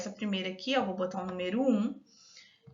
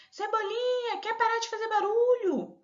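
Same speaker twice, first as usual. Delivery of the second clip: high-pitched mock scolding dad; comic telling-off, pitched way up; scolding father; 11 semitones higher than usual. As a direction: act out a parent fed up with noise. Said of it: "o pai briga com ele".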